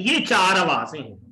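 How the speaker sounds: ordinary delivery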